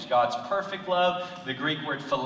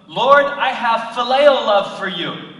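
loud, high, slow declaration; Peter's earnest reply; 8 semitones higher, 15 dB louder, slower than usual. Acted. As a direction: Peter calling it out slowly. Peter answering Jesus, acted.

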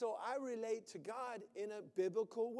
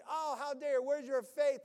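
loud, higher scolding God, hammed up; mock angry God; 5 semitones higher, 10 dB louder than usual. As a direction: bark it like an outraged deity. False image of angry God.

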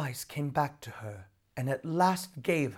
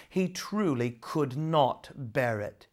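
usual speaking voice

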